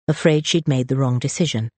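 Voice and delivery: ordinary delivery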